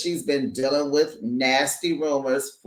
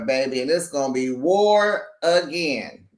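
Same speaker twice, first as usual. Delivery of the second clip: ordinary delivery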